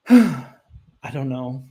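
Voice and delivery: heavy sigh, then drawn-out resignation; exasperated, slow letting-go; frustrated sigh. Real, not acted. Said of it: "It's frustrating".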